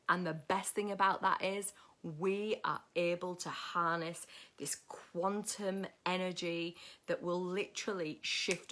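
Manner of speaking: low, soft, inspirational preaching; hushed motivational speech to the tribe; soft spiritual talk. Acted. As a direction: murmur it like a gentle sermon. Soft spiritual pep talk to viewers.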